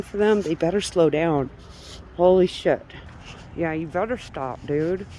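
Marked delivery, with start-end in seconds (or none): none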